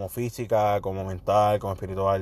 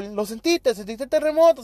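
pregunta incrédula; 13 semitones higher than usual. Retold or imitated. Amigos preguntan por el temblor.

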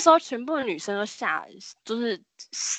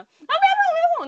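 shrill, much higher panicked shriek; frenzied scream; 5 dB louder, much faster than usual. Retold or imitated. Possessed boy leaping up and flailing at classmates, frantic scream. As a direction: shriek it as you leap up flailing.

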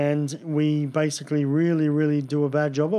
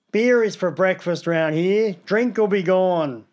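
put-on slow broad ochre Aussie accent, higher and a bit louder; bad Brian Brown impression; 3 semitones higher, 3 dB louder, slower than usual. Mocking Brian Brown's broad Australian accent. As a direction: drawl it out in thick outback Australian. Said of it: "shite impressions".

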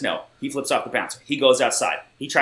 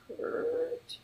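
faint, high, far-off croak of a name; barely managing the name, mock weak; weak groggy voice; 12 dB quieter than usual. Friend waking him on the phone, faint and weak. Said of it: "and weakly, I hear".

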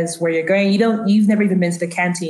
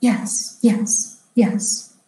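hushed, airy separated words; confused elderly woman; 3 semitones higher, much slower than usual. Acted. Elderly woman accepting an invitation, hesitant and airy. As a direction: leave pauses between each, mostly without voice. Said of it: "she pauses and she looks confused".

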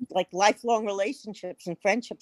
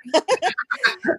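laugh; 10 semitones higher, 4 dB louder than usual. Real, not acted. Laughing at own uncertainty.

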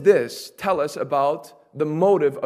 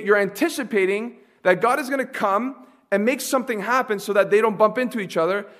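ordinary delivery

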